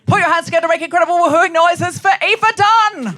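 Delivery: loud, high crowd hype; announcer hype. Real, not acted. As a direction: sell the introduction big. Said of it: "host introducing guest".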